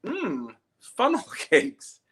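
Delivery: high, slow, savoring mom voice; hammed-up high old mom; mock mom voice. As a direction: drawl it like mom dreaming of funnel cake. Mom's intended comment voiced.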